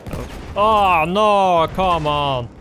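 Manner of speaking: loud slow groan of dismay; frustrated cry at being shot; man down shout. Real, not acted. Killed in game, frustrated outburst.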